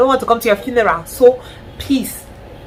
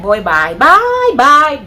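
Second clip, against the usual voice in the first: sing-song dismissal, jumping much higher and louder; boy bye; 5 semitones higher, 6 dB louder, much slower than usual. Pretend. Dismissing an abusive man, loud sing-song goodbye. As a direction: wave him off, loud and high. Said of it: "the first one that I'm just like".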